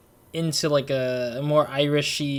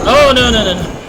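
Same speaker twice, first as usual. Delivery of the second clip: much higher, loud panicked no no; panicked cry; 7 semitones higher, 13 dB louder than usual. Real, not acted. Gamer barely dodging an attack, loud panicked cries.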